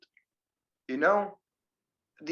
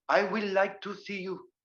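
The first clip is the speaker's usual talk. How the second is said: higher-pitched imitation of an African English accent; 4 semitones higher than usual. Teacher imitating African English speakers, African accent. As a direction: put on African English, each word uncontracted. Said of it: "this is like African English".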